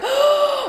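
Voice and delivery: high, sudden audible gasp; sharp gasp. Shown as a demonstration. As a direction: gasp as a startled parent would. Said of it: "your heart is like".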